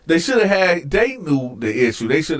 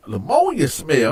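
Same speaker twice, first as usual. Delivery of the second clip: ordinary talk, nothing marked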